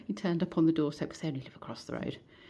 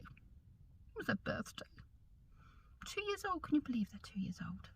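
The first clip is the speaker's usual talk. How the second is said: doting whisper; 7 semitones higher, 12 dB quieter than usual. Real, not acted. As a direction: coo over the pets. Doting talk about pets.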